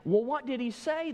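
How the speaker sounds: high-pitched, eager stylist's question; playfully incredulous; mock amazement. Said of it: "the stylist in shock looked and said".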